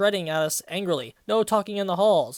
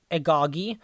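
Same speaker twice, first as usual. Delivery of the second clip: ordinary talk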